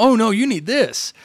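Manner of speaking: high, slow, pushy salesman voice. Retold or imitated. Salesman pushing the new tablet on a customer, hammed-up pushy. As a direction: lean in and push the product.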